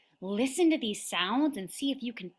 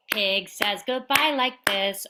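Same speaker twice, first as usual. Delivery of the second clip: ordinary talk, nothing marked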